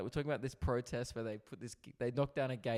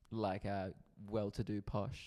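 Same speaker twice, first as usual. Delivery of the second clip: slow, soft posh English; a hint of upper-class English; posh English accent; 6 semitones lower, 6 dB quieter, slower than usual. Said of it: "like English, but, like, proper".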